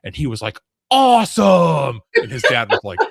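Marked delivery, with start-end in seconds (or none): 0.9-2.0 put-on high, loud, drawn-out thrilled kid shout. Twelve-year-old player cheers his gross success, stretched loud shout. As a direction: belt it like an overjoyed kid.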